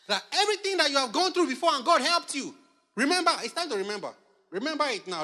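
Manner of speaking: high, loud preaching; fervent exhortation. Real, not acted. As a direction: drive it home to the congregation. Preacher exhorting congregation to remember.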